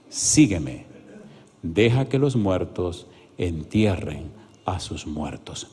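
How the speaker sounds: slow, low, measured reading; solemn scripture reading. Acted. Pastor reads Jesus' reply to the scribe, solemn and slow. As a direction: let each phrase land, low and slow.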